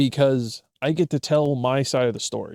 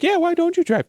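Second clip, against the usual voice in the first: mock high teasing; 4 dB louder than usual. Teasing quote to friend.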